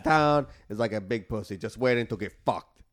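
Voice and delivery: put-on a loose, lightly done Cuban gangster accent; a half-hearted Tony Montana Cuban accent; shitty Scarface accent. Quoting Scarface to friends, in a weak Cuban gangster accent. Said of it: "I'm doing a shitty accent".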